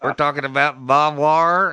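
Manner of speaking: slow, loud Texas drawl; Texas accent. Shown as a demonstration. Talking to folks from Texas, thick Texas accent. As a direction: lean hard into a Texas accent. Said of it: "I get right back into it".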